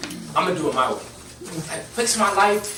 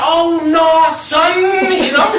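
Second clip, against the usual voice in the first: much higher, louder mock outrage; pitched-up imitation of an outraged friend; big guy's reaction; 10 semitones higher, 6 dB louder, much slower than usual. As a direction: play the huge friend going oh no son. Huge Baltimore guy quoted.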